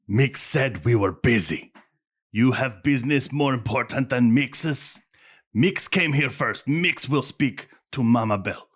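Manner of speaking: gruff stranger insisting on priority; brusque half-mechanical woman. Acted. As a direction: play a stocky, impatient gatekeeper.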